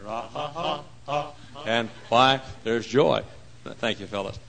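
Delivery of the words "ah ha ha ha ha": staged, spaced-out ha-ha; acted laughter. Fugitives singing of laughter, deliberate acted ha-ha. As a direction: laugh in separate, deliberate ha's. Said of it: "He filled my mouth with laughter".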